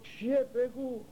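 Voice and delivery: anxious brother. Retold or imitated. Brother anxiously asks the king.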